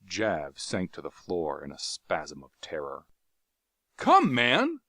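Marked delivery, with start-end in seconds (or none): 4.0-4.8 high, loud urging call; rousing cry. Acted. Carthoris urging the cowering Jav up. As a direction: rouse him to his feet. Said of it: "cried Carthoris".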